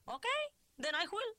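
put-on high-pitched accented retort; fine, do it yourself defiance; defiant Cuban mother. A narrator's reading. Mother defiantly takes over.

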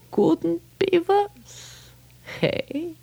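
slow high wry echo; musing rabbi. Acted. Rabbi echoing the complaint, slow and high. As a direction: stretch it out, amused.

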